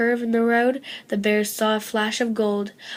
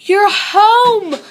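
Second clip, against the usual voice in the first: loud, higher joyful shout; 10 semitones higher, 12 dB louder than usual. A narrator's reading. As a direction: call out with delight. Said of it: "Goldie shouted".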